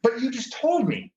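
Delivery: retold high-pitched, baffled objection; acted-out baffled pushback; incredulous protest. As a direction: protest in disbelief, pitched well up.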